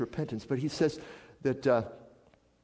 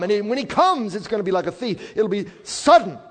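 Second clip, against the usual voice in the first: loud, raised preacher's voice; impassioned preaching; 8 semitones higher, 10 dB louder than usual. Real, not acted. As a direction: press it on the congregation, high and forceful. Preaches at full force.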